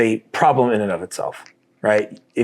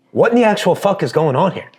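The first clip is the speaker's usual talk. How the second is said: exasperated outburst; 8 semitones higher, 4 dB louder than usual. Retold or imitated. Retold angry outburst at home.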